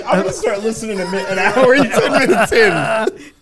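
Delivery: laughing mock listener. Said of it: "some dude's like".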